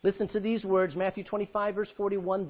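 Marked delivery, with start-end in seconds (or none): none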